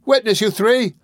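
high, loud call to witnesses; boy's cry. A narrator's reading. Boy calling on three onlookers, crying out. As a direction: cry out loudly, pitched high. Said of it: "cried the boy".

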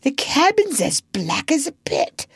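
high-pitched, frightened report; almost gibbering. A narrator's reading. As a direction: report it close to panic. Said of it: "almost gibbering".

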